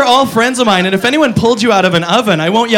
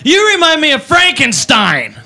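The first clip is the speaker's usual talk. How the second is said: put-on drunk girl; 4 semitones higher than usual. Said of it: "She goes".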